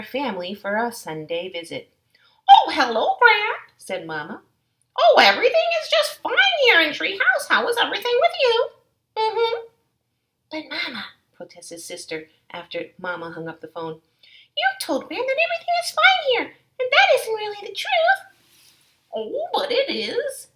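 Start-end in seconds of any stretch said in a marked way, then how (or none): 2.5-9.7 higher, bright-toned mother; Mama chatting to Gran, higher; cheerful Mama on phone. Acted. 10.5-18.2 put-on very high girl-cub voice, quick; protesting Sister.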